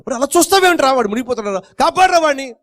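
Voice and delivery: high, pleading cry; desperate urging. Acted. Friends urging the idle swimmer, high desperate plea. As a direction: push him high and urgent.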